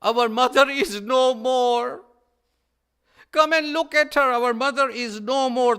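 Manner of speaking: loud, high wailing call, slowed on the bad news; anguished scream. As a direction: cry out to his brother over their mother's body. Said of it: "he screamed and he said".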